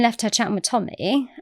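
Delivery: normal talking voice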